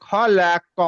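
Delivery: খলা ক with a slight break before the ক, which is bounced and then stopped; stop with bounce. Shown as a demonstration. Teacher shows the class the bounced stop on qaf. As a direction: split খলা ক and let the ক echo before halting. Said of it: "খলা ক বলে থেমে যাবো".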